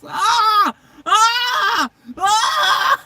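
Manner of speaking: high, loud screams one after another; repeated tunnel screams. Retold or imitated. Head out the car window in the tunnel, high loud repeated screams. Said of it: "It was".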